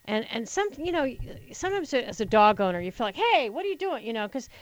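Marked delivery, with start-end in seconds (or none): 3.1-4.0 higher-pitched, protesting "hey"; mock indignant challenge. Dog owner to a stranger petting the dog, mildly indignant. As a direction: act out a protective owner's objection.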